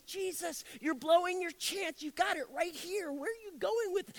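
high, urgent disciple pleading with Jesus; a disciple begs Jesus not to waste the chance; disciples protesting. Retold or imitated. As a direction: plead with him, pitch up high. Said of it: "I can only imagine his disciples".

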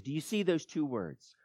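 usual speaking voice

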